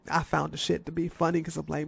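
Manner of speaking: ordinary talk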